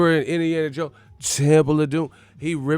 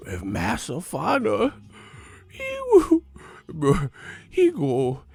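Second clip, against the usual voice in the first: slow caricature with high, swooping leaps; hammed-up alarm, stretching words into high hoots; mock freed slave; 7 semitones higher, 3 dB louder, slower than usual. Streamer mocks rivals as runaway slaves, caricature voice. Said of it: "These motherfuckers free slaves".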